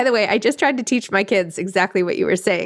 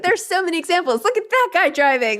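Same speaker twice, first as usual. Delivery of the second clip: amused protest; 10 semitones higher than usual. Retold or imitated. Acted kids' reply, laughing.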